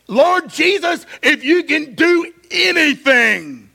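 impassioned preacher voicing a plea; desperate plea. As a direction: beg loudly as a desperate father. Father begging Jesus for his son.

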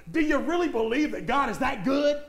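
usual speaking voice

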